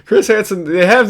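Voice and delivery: slow, laughing through the words; amused, chuckling as you speak. Real, not acted. Laughing at the clip.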